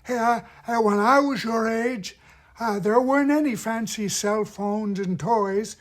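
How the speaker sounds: put-on high, loud, airy old-timer, tight throat; old person. Shown as a demonstration. Old puppet recalling childhood to a kid, tight airy old voice. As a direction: hunch over and creak it out high. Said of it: "hunch over a little bit".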